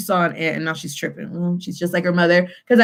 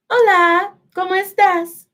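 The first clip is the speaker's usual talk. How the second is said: high, lilting greeting; light high put-on voice, stretched hello; second character's voice; 10 semitones higher than usual. Shown as a demonstration. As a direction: answer as the other person, pitched well up. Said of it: "I will change my voice".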